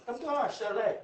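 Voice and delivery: playful put-on Mexican accent rolling out "chalet". Shown as a demonstration. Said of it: "when you say it with a Mexican accent".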